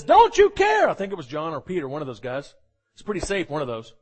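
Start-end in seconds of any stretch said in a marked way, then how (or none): 0.0-0.9 high, loud, panicked accusation; mad disciple. Acted. Disciple in the storm shouting at Jesus, angry and high. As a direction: cry it out at a sleeping Jesus. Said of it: "John gets mad and says".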